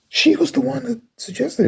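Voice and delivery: high, exasperated insistence; indignant. Real, not acted. Insisting the friend suggested the meetup, a high indignant repeat. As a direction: push it up high in disbelief.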